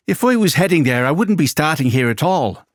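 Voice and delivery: half-hearted Irish lilt for the punchline; mock Irish accent. Presenter reads a listener's punchline in a mock Irish accent. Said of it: "this kind of needs to be said in an Irish accent, which I can't do".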